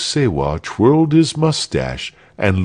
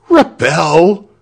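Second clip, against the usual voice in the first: loud, high, rising exclamation; crowd cry; 9 semitones higher, 6 dB louder than usual. A narrator's reading. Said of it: "cried everybody".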